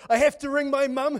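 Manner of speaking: high, loud eager rush; bursting to tell her mum; excited girl. Retold or imitated. Preacher voicing girl eager to call her mum, excited.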